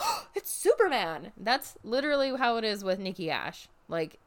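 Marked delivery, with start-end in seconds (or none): 0.0-1.3 mock realization. Mock Superman reveal.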